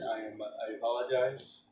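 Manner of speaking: usual speaking voice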